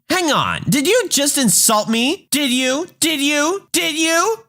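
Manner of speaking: put-on a childish tantrum squeal growing with each repeat; shrieking Karen, building. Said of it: "She's screaming and crying like a child".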